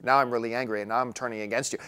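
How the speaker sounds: no change of emotion at all, flat; same monotone, mock angry. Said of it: "to doing this".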